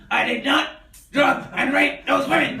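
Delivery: loud, slowed mock denial; hammed-up celebrity denial; Bill Cosby. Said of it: "have him playing Bill Cosby".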